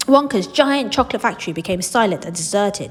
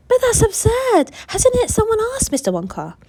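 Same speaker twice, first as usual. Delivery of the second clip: incredulous protest; 14 semitones higher, 4 dB louder than usual. Acted. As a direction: refuse to believe it. Charlie protests in disbelief.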